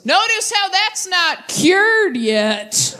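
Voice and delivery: high, slow snide jab; snide dad. Retold or imitated. Dad mocking mom's ex, slow high snide jab. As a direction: savor the dig slowly. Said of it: "my dad goes".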